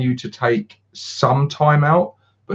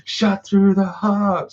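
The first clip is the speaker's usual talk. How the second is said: mock dramatic; 10 semitones higher, slower than usual. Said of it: "says Hobbygate".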